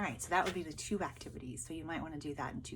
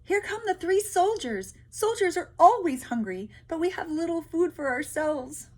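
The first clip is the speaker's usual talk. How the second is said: much higher, louder, fretful villagers; worried peasants; 11 semitones higher, 12 dB louder than usual. Acted. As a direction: panic about the soldiers eating their food. Peasants voiced in story.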